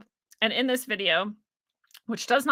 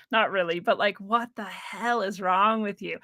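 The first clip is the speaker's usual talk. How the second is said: ordinary talk, nothing marked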